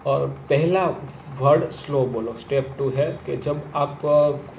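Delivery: ordinary delivery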